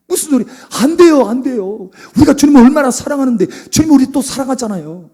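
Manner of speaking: acted-out wheedling objection; pleading protest. Acting out Peter pleading with Jesus.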